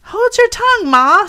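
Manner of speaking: high, loud snap at Ma; snappish. Acted. Young crab snapping at her mother, high and loud. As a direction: bite back at her mother. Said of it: "a little snappishly".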